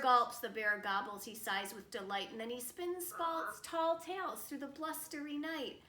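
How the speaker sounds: rhyming story narration. A narrator's reading. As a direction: lift the rhyme toward the bear's lair.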